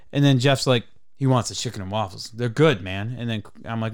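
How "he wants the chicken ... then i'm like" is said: ordinary talk, nothing marked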